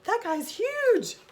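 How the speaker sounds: high, slow, loud frightened gasp of words; scared Gerald. Acted. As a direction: gawk up at something huge. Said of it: "eyebrows get really high".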